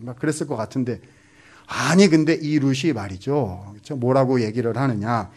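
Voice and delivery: ordinary talk, nothing marked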